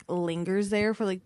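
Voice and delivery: ordinary talk, nothing marked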